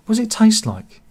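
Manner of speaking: acted curiosity, pitched high; high, mildly hammed-up eager question; eager customer. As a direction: ask as the keen punter in a joke. Curious customer in a told joke.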